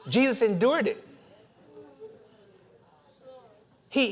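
loud, high, impassioned preacher's cry; fiery preaching with a laugh; full-force preaching. Acted. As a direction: preach it out at the top of your voice, laughing through it.

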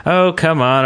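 ordinary talk, nothing marked

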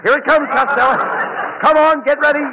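rally him before the wave hits; urgent wave warning. Acted.

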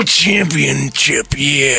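slow, drawn-out wrestler growl after the shouting; Macho Man. Acted. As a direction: drop down and stretch out the title. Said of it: "Gotta work on my Macho Man".

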